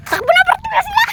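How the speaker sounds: high, loud urgent shout; excited shout. Real, not acted. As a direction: yell at everyone to run. Calling to run from chasers.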